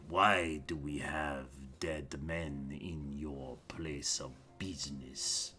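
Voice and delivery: low, slow-burning captain voice, dropping to a whisper; a watch captain holding back threat, hushed then flaring; city watch captain. Acted. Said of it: "and says".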